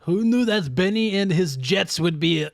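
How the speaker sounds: hammed-up French accent. Shopkeeper astonished at [name] in French accent. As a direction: marvel at it in a mock French accent. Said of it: "That French sneaker store".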